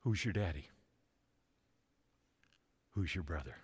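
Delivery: normal talking voice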